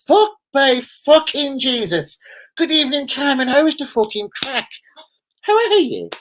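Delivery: high, hammed-up Irish greeting; put-on Irish accent. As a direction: lay on a high-pitched Irish brogue. Greets Cam in put-on brogue.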